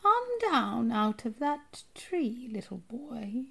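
high, very slow metallic rasp; rasping witch voice. A narrator's reading. Strange woman coaxing a little boy down, rasping. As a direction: lure slowly, fading to a hushed rasp. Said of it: "Her voice had a curious, rasping quality".

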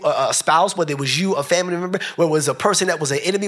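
ordinary delivery

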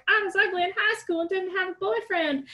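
high-pitched mock model voice; hot model's sob story. Guest mocking the typical story hot models give, put-on model voice. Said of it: "hot models always give".

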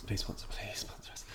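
unvoiced begging whisper. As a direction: whisper the plea cheekily. Whispered joke plea.

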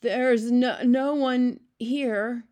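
high, halting, slowed denial; nervous stammer. A narrator's reading. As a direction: stumble over the words nervously. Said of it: "he stammered".

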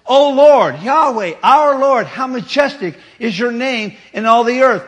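loud, exalted proclamation; proclaiming psalm. Acted. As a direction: proclaim God's name with awe.

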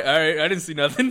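high, loud hammed-up shrug of a dad; joking about the father ignoring the kids; mock careless dad. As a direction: play the dad who looks away, mockingly. Mocking careless dad walking by.

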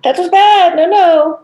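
high, loud mock scold of a child; sing-song no-no at a child with a marker; high scolding singsong. Teacher scolding a child who chews the marker, high and loud.

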